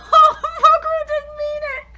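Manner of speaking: laughing hard while speaking; bursting into loud laughter as you react. Real, not acted. Laughing reaction reading manga.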